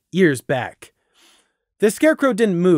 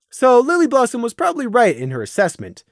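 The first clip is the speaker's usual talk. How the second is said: plain reading voice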